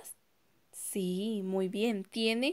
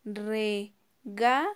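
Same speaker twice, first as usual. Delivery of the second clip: "Regalo." drawn out very slowly as "re-ga-lo"; sounding out slowly for children; slow syllables; much slower than usual. Shown as a demonstration. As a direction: stretch each syllable apart. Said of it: "Separémosla por sílabas".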